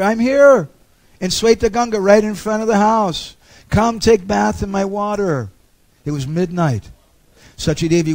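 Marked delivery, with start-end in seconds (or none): 0.0-5.5 goddess calling. Retold or imitated. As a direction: call her like a goddess in a dream. Ganga Devi speaking in dream.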